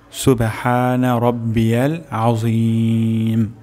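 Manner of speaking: slow, drawn-out Arabic tasbih; correct recitation. Shown as a demonstration. Teacher introducing the ruku tasbih to learners, correct slow recitation. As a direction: recite it carefully, stretching the long vowels. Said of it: "সহিহ উচ্চারণ শিখব".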